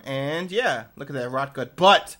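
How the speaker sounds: usual speaking voice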